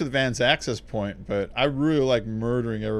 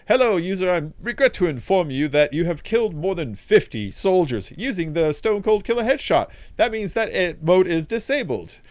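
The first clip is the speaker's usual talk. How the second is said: higher, prim bullet voice breaking bad news; a cheerful little gun reading a rule; talking gun Skippy; 6 semitones higher, 4 dB louder than usual. Talking gun Skippy telling the player his mode is gone, higher and prim. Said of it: "he goes".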